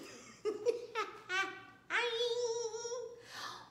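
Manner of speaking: high laugh rising into a long squeal; giggle, then squeal with delight. Real, not acted. Delighted reaction to the romance.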